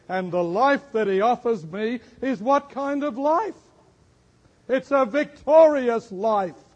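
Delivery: high, emphatic preaching voice; forceful preaching. Real, not acted. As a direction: build to a loud, high peak, then ease down.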